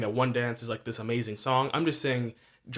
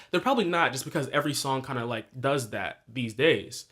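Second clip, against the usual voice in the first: normal talking voice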